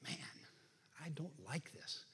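quiet disapproval. Acted. Preacher acting out churchgoers put off by showy religion, hushed disapproval. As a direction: grumble it softly to yourself. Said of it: "shake our head and we go".

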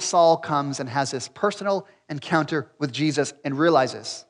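ordinary talk, nothing marked

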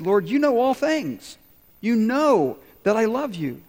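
plead, stung by the question; hurt. Acted. Peter answers Jesus, asked a third time, mildly hurt. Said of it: "Peter was hurt".

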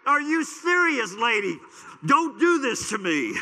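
loud, high, slowed cry of disbelief; Are you serious stretched out in outraged disbelief; exasperated disbelief. Retold or imitated. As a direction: yell at the GPS like it betrayed you. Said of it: "I'm like".

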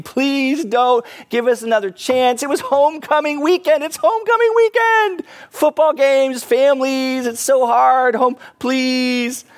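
high, hammed-up wailing plea; begging. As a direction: beg desperately in a very high whine. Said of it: "they're just begging".

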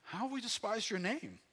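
high-pitched, affronted question to God; protesting people. Acted. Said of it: "But you say".

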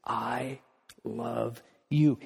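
soft and low, almost hushed; tender assurance. Pretend. God answering Steve's doubt, soft and tender. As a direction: say it close and gently. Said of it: "The reason he would choose Steve is".

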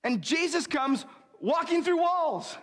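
ordinary delivery

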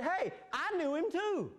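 high, eager shout of joy; excited shout. A narrator's reading. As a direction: call it out like a thrilled kid. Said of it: "he shouted".

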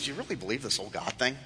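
low, soft scoff with a small chuckle; hushed, chuckling taunt; mocking serpent. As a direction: quietly sneer at their faith. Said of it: "The serpent laughs at you and says".

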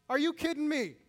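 flat mock refusal, tongue in cheek; what Jesus does not say, with falling scorn; indignant outburst. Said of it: "Is that what Jesus says?".

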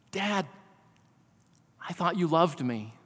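put-on soft, hushed, wounded child's words; quiet, retold plea of a hurt little boy. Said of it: "In the midst of his sobbing and crying".

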